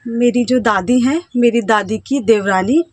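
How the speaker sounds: ordinary talk, nothing marked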